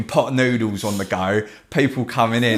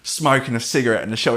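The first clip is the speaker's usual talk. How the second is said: ordinary delivery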